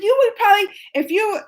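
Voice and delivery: mother reliving her anger at the teacher; retold angry telling-off. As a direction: replay the fury at the meeting. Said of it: "and then I got mad".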